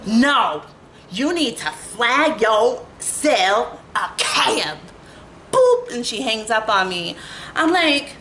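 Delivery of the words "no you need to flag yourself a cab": slow, bossy, talking down; mock rude dispatcher. Mimicking the cab dispatcher who orders her to flag a cab. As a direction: lecture her like a curt dispatcher. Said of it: "the attitude".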